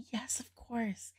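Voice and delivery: slow amused whisper. Real, not acted. Reviewer slowly whispering that the song is cliche. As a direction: concede it quietly and slowly, like a confession.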